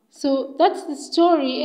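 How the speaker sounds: plain reading voice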